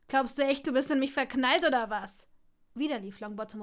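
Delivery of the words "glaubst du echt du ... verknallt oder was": louder, scornful challenge; loud retort. Acted. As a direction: throw it in his face. Draco hits back at Neville.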